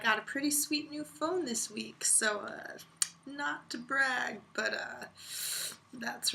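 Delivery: whispered, sly brag; slow hushed bragging whisper. Real, not acted. Bragging about new phone.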